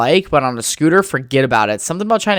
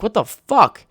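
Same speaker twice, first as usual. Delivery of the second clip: exasperated; 9 semitones higher, 3 dB louder, slower than usual. Retold or imitated. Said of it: "I'm like".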